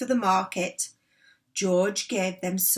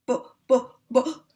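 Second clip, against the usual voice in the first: stammering; 5 semitones higher, 5 dB louder than usual. A narrator's reading. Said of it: "stammered George's mother".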